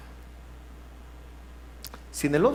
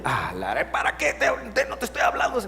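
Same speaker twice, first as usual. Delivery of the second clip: annoyed spouse; 7 semitones higher, faster than usual. Acted. Spouse snapping at the intruder.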